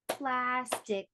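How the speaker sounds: "Plastic" stretched over two beats; syllable-by-syllable "Plastic"; split into syllables. Shown as a demonstration. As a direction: clap out "Plastic" as plas, tic. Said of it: "How many syllables?".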